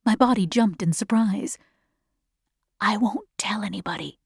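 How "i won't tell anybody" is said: soft, hushed whisper; whispered reassurance. A narrator's reading. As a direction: keep it secret, barely voiced. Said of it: "whispered the gray-haired man".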